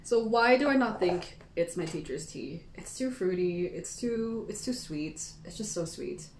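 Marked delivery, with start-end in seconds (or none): none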